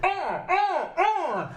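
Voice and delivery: very high robot dog yelps; short repeated puppy-like cries; Buddy. Acted. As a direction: yap eagerly at the top of the voice. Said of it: "turns, looks at Alison and goes".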